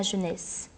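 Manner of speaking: "jeunesse" ending on the s sound; silent final E. Shown as a demonstration. Teacher showing an E at verse end, "jeunesse" with silent E. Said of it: "le E final du mot jeunesse ne se prononce pas".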